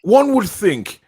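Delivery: high, loud mock posh voice; hammed-up royal accent; posh impression. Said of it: "[name]'s English ... Prince Charles".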